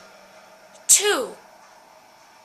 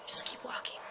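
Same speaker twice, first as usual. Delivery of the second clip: "Just keep walking" whispered, no voice; eerie whispered advice; 5 dB quieter than usual. Shown as a demonstration. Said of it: "repeats it whispered".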